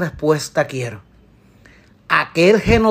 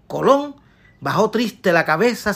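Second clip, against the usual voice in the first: ordinary talk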